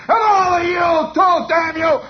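loud, high imitated rage; yelling. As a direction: holler curses at the whole class. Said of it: "Farkas yells down at all the kids".

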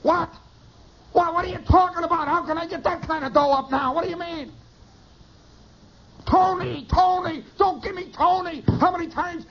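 high, strained mock holler; yelling on phone. Pot dealer in the next booth, mock yelling. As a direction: rant at full pitch into the receiver. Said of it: "he's yelling on the phone".

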